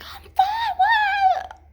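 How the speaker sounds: very high, squeaky mock denial; badly faked fine. Hurt diver brushes off worried friends, squeaky fake okay. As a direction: insist in a falsetto that nothing's wrong.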